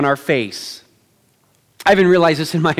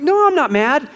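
high-pitched defensive denial; mock protest; 8 semitones higher than usual. Dad answering his kids, high mock protest. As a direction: protest innocence in a raised pitch. Acted dad denying he is mad.

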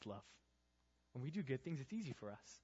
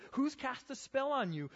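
higher, louder, pleading; urgent rebuke; 12 semitones higher, 8 dB louder than usual. Shown as a demonstration. Pastor pressing the youth group, high and loud. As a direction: cry it out to the crowd.

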